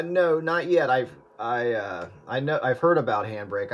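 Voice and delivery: ordinary talk, nothing marked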